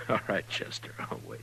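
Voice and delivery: soft whisper, still amused from the laugh. Acted. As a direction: whisper it as if humoring a friend. Amused marshal, after a laugh.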